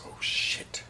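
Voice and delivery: whispered oh-no moment; sudden realization. Acted. Soldier alone over the top, whispered realization. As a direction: whisper it as the danger dawns. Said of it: "and suddenly he realizes".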